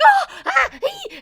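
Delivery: high, loud squealing gasp; alarmed squeal. Retold or imitated. Fan watching her hero get hurt, squealing in alarm. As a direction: squeal in dismay at the wound. Reacting to hero getting injured.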